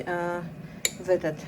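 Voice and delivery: usual speaking voice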